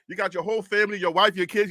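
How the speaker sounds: ordinary talk, nothing marked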